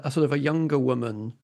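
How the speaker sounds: usual speaking voice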